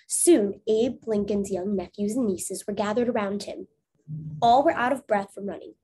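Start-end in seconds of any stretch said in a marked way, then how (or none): none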